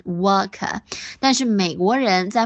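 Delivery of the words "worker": British "wuh-kuh" for "work", plain schwas; non-rhotic British "worker"; British accent. Shown as a demonstration. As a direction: drop both r sounds, British style, in "work". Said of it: "都是呃的音".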